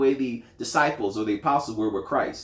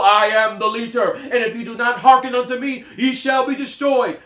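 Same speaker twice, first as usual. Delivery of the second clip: mock haughty; 6 semitones higher, 5 dB louder than usual. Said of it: "didn't assert himself as though".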